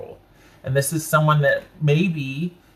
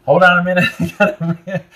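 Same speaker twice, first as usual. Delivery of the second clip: laughing through the words, higher at first; real laughter; 4 semitones higher, 7 dB louder than usual. Real, not acted. Reader catching herself getting mad mid-reading, laughing. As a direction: break up laughing while asking for a pause. Laughs at own sudden anger.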